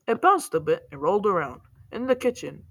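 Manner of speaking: ordinary delivery, nothing marked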